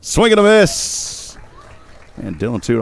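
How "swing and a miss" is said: high, drawn-out strikeout call; excited call. Real, not acted. Announcer calling a swing and a miss, excited. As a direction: belt it out slowly, pitch leaping up.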